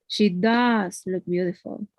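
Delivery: slow, DOES stretched long; stress on DOES. Shown as a demonstration. Teacher emphasizing how beautiful she looks, long stressed does. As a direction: hold the word does for emphasis. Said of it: "it's emphasizing how beautiful she looks".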